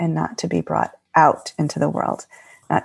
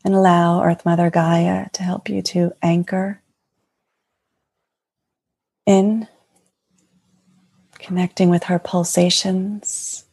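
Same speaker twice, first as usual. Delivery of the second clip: guided meditation. Acted. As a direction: gently guide them into their hearts. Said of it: "would you like to, to lead us in something".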